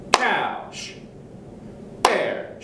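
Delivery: "couch" and "bear" cut short; short sound. Shown as a demonstration. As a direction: clip each word short. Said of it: "one of them is a short sound".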